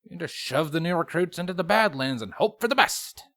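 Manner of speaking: high, sarcastic sergeant voice; high-pitched sarcastic wisecrack; wisecracking remark. A narrator's reading. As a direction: sell the joke with a high wry sneer. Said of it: "Longshot remarked".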